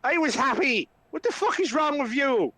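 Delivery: high-pitched exasperated rant; Flynn, accusing. Acted. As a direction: tear into him without shouting. Flynn rounds on Ismark, accusing.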